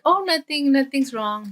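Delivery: high, too-quick denial; feigned innocence. Acted. Boy hides guilt from dad, high innocent denial.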